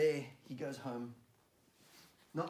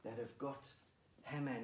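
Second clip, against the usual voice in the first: ordinary delivery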